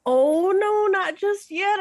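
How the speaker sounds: high, loud protest; hasty acted refusal. Traveler putting off druids eager for the ritual, loud high refusal. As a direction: jump in to head off their hopes.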